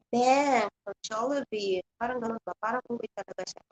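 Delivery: ordinary delivery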